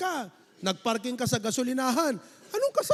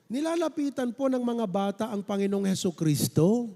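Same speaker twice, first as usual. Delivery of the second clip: ordinary delivery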